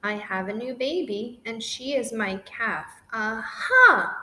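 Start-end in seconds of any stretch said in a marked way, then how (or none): 3.1-4.2 loud triumphant shout. Acted. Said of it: "We all shouted".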